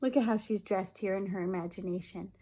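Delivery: ordinary delivery, nothing marked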